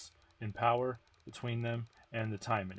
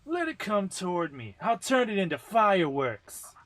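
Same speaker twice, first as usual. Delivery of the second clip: higher, louder confident boast; cocky Micah; 9 semitones higher, 8 dB louder than usual. Acted. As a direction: brag that she'll blow it apart.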